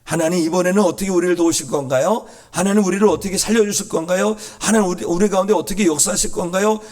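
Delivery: high, insistent questions to God; expectant prayer. Acted. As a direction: ask eagerly, pressing each question.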